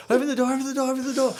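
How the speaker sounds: very high, loud panicked plea; acted frantic squeal over the radio; full panic. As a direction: yell for the door as if being chased. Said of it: "the full panic".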